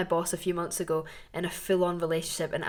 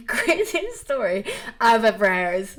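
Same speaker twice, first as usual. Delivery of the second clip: normal talking voice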